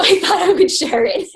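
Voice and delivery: laughing through the words. Real, not acted. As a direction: giggle while finishing. Laughing at own joke.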